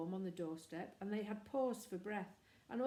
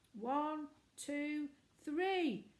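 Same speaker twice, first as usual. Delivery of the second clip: high, louder counting call; 7 semitones higher, 4 dB louder, much slower than usual. A narrator's reading. Boy counting his siblings in for a shout, loud.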